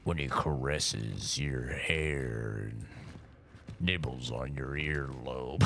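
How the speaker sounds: very low, slow, soft murmur; deep, drawn-out mock-sensual intimate voice; put-on seductive voice. Shown as a demonstration. Streamer showing off voices, slow deep mock seduction. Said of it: "I've done all sorts of fucking voices".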